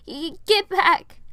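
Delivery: high, loud, slow stammered warning; a scared child holding them off; frightened shout. A narrator's reading. Piper warns the princess away with her sword, in a high scared shout.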